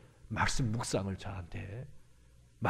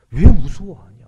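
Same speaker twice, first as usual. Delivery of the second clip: loud, raised rebuke; Jesus; 5 semitones higher, 19 dB louder, slower than usual. Acted. Jesus challenging the disciples' fear, loud rebuke. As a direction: hit the question hard, much louder and higher. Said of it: "책망하십니다".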